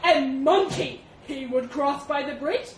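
loud, booming elephant challenge; accusing elephant. Acted. Elephant cross-examining the mouse, loud and accusing. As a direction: press the accusation loudly.

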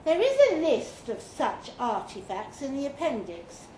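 plain reading voice

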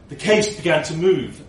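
plain reading voice

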